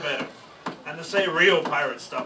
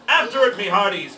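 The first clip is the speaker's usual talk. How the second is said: loud, higher command; pirate command; 4 semitones higher, 7 dB louder than usual. Acted. Pirate captain sends crew after the ball, loud command. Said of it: "Braidbeard commanded".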